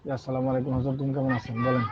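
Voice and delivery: hushed voice into the phone; whispering on the phone. Real, not acted. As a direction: keep it low, others are nearby. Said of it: "আমি এখন ক্লাসে আছি তো".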